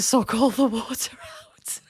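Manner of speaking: drops to a horrified whisper. Real, not acted. Mother whispering the gross detail of her toddler's habit. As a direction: whisper it like it's too gross to say aloud.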